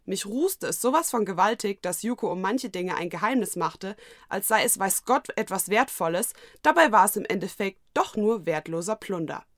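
usual speaking voice

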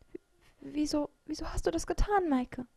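high, stammered plaintive question; close to tears. A narrator's reading. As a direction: stumble over the first word, on the verge of crying. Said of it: "Sie war den Tränen nahe". (den Tränen nahe, stotternd)

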